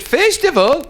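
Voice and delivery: high, emphatic instructing voice; Columbus. Acted. Columbus begins his lesson to the chief, high instructing voice. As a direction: pitch it up as if teaching a beginner. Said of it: "this is Columbus again".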